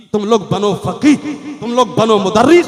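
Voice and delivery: booming, very loud rallying call; full-force exhortation. Real, not acted. Preacher urging madrasa students to become scholars, booming exhortation. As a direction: thunder it at the students.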